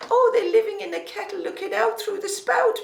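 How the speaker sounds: high sing-song Irish granny impression; exaggerated high Irish lilt. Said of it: "she had a beautiful lilting voice".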